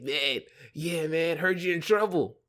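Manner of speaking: imitating a gloating friend with a smile; smiling bad-news bearer. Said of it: "that smile every time they give you bad news".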